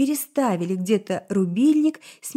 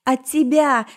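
louder, anguished cry; shouted; 4 semitones higher, 6 dB louder than usual. A narrator's reading. Elf screams at her other personality, anguished shout. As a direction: scream it at the alter ego. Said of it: "закричала эльфийка".

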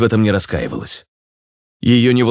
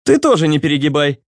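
high-voiced mild request; asking; 9 semitones higher than usual. A narrator's reading. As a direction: ask him to ease off, pitch raised. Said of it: "попросил Руслан". (высокая, просительная интонация)

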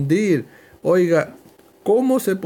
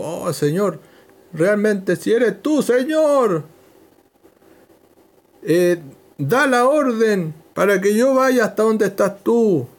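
Peter; 5 semitones higher than usual. Retold or imitated. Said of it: "se hizo el valiente".

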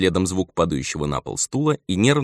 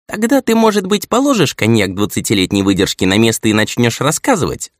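put-on raised, louder impatient old man; 5 semitones higher, 6 dB louder than usual. A narrator's reading. Old man tells him to drop the cognac and report, impatient. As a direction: nag him to get talking. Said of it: "нетерпеливо произнёс старик".

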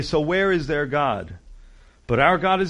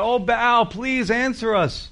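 high, pleading plea, slow at the end; crying out; 8 semitones higher, slower than usual. Retold or imitated. Prophets begging Baal at the altar, high pleading cry. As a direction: beg the idol to answer. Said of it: "That's what they're crying out".

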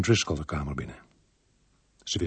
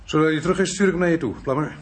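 luid roepen; 8 semitones higher, 4 dB louder, faster than usual. Acted. Calling out to Plummer.

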